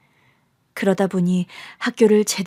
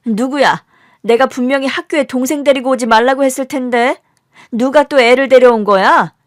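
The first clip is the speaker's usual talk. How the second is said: loud, higher-pitched reprimand; teacher's angry scolding; 5 semitones higher, 8 dB louder than usual. Acted. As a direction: demand to know who disobeyed. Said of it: "선생님의 불호령이 떨어졌고". (선생님의 불호령)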